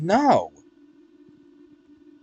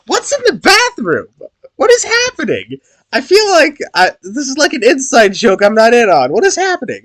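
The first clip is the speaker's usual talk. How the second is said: very high, loud, disbelieving; baffled outcry; 12 semitones higher, 9 dB louder than usual. Real, not acted. Streamer confused by the bathroom scene, very high and loud. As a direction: shriek it in confusion.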